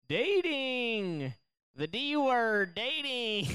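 high, drawn-out topic reveal; playful announcement. Real, not acted.

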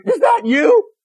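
high, sweet movie-scene voice; mock Hallmark whisper-tender. Preacher spoofing a Hallmark deathbed: high sweet voice. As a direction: play the TV-movie dying loved one.

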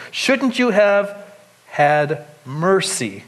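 drawn out very slowly, weighing each word; slow rebuke. Acted. Master rebuking the wicked servant, slow and grave. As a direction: let the reproach sink in slowly.

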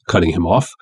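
ordinary delivery, nothing marked

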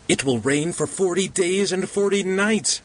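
high, moaning lament. A narrator's reading. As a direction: whine it out in misery. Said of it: "said the pudgy man moaningly".

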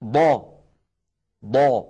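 ضا full and dark, pressed up to the palate; heavy letter demo. Shown as a demonstration. Teacher has viewers try it, heavy ضا. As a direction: say ضا with a heavy back vowel. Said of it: "جرب هكذا".

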